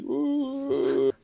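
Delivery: squeaky high-pitched "ooh"; a sudden high squeal. Retold or imitated. Mocking awkward guy with hook.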